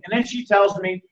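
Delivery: ordinary delivery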